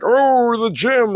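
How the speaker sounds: high-pitched mock whine; mocking imitation. Teller mimics rival's gym excuse, high mocking voice.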